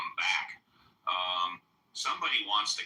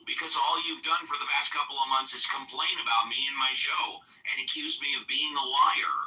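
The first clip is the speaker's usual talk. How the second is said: raised, indignant refusal; angry; 4 semitones higher than usual. Real, not acted. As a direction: shut him down, heated. Host refusing heckler's funding request.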